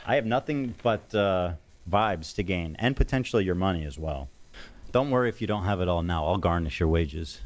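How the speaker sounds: ordinary talk, nothing marked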